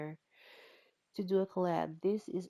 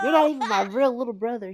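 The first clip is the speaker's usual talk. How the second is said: much louder, higher hammed-up jab; mean taunt; 5 semitones higher, 13 dB louder, faster than usual. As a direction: spit it at the sibling in mock spite. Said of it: "He's like".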